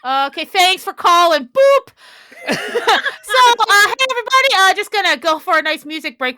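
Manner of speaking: high, loud, chipper on-air announcer; cheesy DJ voice cutting to a break; radio announcer. Acted. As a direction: sell the music break brightly. Host goes back on air.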